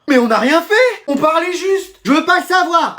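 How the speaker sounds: high-pitched squabble; indignant protest. Acted. Pupils sent to the headmaster, indignant back-and-forth. Protestation après renvoi chez le directeur.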